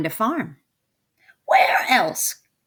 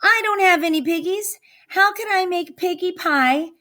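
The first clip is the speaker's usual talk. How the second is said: high, fast, frantic witch voice; upset witch; 12 semitones higher, 3 dB louder than usual. Acted. Witch lamenting her empty pantry, high and frantic. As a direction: fret like a panicking hag. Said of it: "screeched Gritch".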